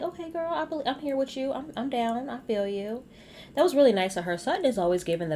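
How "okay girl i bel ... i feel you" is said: put-on higher, bright "okay girl" agreement; playful high-voiced cheering on; mock eager agreement. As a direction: pitch it up like a hyped best friend. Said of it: "And I'm like".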